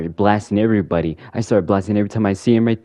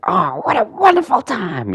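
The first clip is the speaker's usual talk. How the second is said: much higher, gleeful villain voice; Joker voice. Retold or imitated. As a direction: gloat like a giddy villain. Joker voice high.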